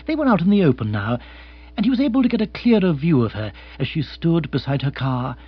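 ordinary delivery

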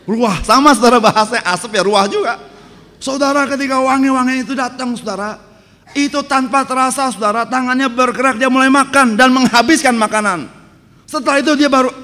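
loud, high, ringing sermon voice; fired-up preacher telling the story; preaching at full force. Acted. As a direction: preach it out to the congregation.

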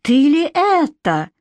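can't believe his eyes; astonished exclaim. A narrator's reading. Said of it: "воскликнул король Ченс".

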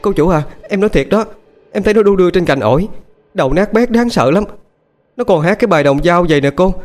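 high-pitched, panicky pleading; frightened maid insisting on what she saw, voice way up; terrified. A narrator's reading. Maid reporting a ghost to her mistress, terrified. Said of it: "Quá hoảng sợ".

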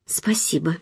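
whispered, falling acceptance; faint repeated thanks. A narrator's reading. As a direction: give in with a very soft whisper, falling at the end. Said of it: "повторила я". (тихим шёпотом, с понижением тона)

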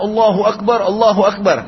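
loud, high tearful cry of praise; weeping cry. A narrator's reading. Four riders weeping over the dead companion, loud tearful cry. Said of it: "Sambil menangis".

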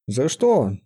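ordinary delivery

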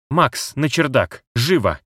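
sharp urgent bark, drawn out; barked order. Acted. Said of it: "рявкнул кот".

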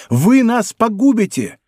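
high, slow urgent cry; alarmed cry. A narrator's reading. Bonacieux warns d'Artagnan he will ruin them, high alarmed cry. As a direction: plead in alarm, pitch well up. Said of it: "вскричала госпожа Бонасье".

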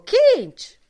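high, loud shout across the room; loud call. A narrator's reading. Buck Mulligan shouting for Kinch from the fire, loud and high. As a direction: yell the nickname to get his attention.

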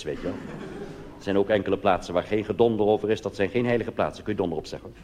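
ordinary delivery

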